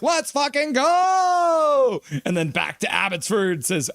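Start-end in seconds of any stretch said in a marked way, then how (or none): 0.0-2.0 drawn-out hype yell. Retold or imitated. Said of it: "Jake Something is like".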